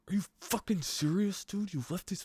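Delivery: high, strained yell squeezed under the breath; mock angry yell. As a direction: yell at the roommate but keep it under your breath. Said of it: "it was me yell screaming under my breath".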